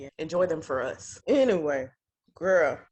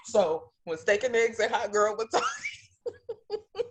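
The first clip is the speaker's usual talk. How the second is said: slow sentence dissolving into real laughter; breaking into laughter; slower than usual. Real, not acted. Retelling to co-host who mocked the nickname, breaking into laughter. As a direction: crack up as you get the words out.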